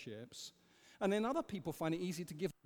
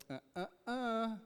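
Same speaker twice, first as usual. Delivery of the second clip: disapproving God; 5 semitones higher than usual. Retold or imitated. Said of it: "we expect God to go".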